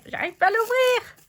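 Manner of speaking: high, whining "j'arrive pas"; whiny complaint. Real, not acted. Struggling to open the jar.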